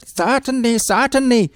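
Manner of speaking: high, breezy repeated certainly; husband agreeing. Acted. Husband agrees while helping himself, high.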